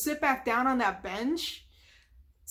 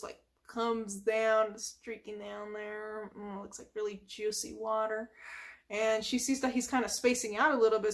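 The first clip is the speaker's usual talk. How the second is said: normal talking voice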